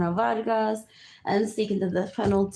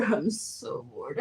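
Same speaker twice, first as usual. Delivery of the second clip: laughing; 7 semitones lower, 5 dB quieter, slower than usual. Real, not acted.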